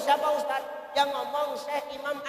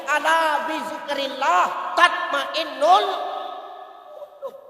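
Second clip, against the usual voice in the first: Quran recitation; 6 semitones higher, 9 dB louder, much slower than usual. Acted. Quoting Quran verse in sermon.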